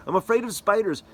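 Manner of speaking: high, loud mock scared whine; scared child. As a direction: squeal it like a frightened kid.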